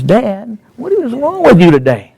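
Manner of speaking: mock a baffled teenager; exasperated son. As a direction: voice jumps up high in disbelief.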